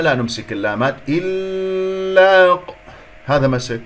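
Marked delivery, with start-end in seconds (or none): 1.1-2.7 إلا drawn out very long on a high, steady note; exaggerated, sustained إلا; over-held sound. Shown as a demonstration. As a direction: stretch إلا far too long, breaking off at the qaf. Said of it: "وأطلت صوتها".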